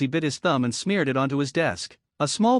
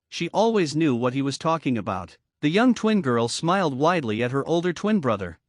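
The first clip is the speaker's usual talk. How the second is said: plain reading voice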